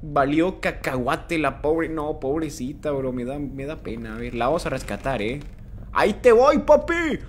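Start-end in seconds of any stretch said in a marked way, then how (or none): 5.9-7.2 loud, high call to the doll. Real, not acted. Streamer yells to Poppy while running to save her, loud call. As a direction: shout as you charge in.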